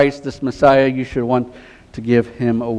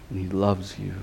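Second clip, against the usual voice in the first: barely above a hush, sincere and gentle; hushed tenderness; 5 semitones lower, 12 dB quieter than usual. Real, not acted. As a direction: let it fall soft and loving. Tender close of appeal.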